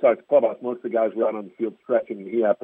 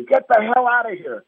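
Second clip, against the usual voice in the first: laughing mock yell; 11 semitones higher, 4 dB louder than usual. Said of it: "just say".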